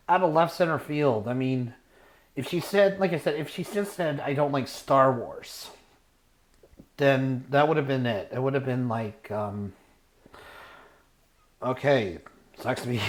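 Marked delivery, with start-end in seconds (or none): none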